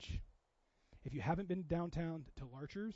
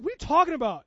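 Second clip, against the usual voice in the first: louder, higher baffled question; baffled disbelief; 7 semitones higher, 12 dB louder, faster than usual. Retold or imitated. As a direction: ask it like you can't believe your ears. Dad baffled by son's request.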